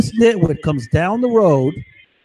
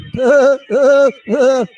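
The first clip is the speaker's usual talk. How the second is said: scornful laugh; 7 semitones higher, 5 dB louder than usual. Real, not acted. Mocking laugh mid-rant.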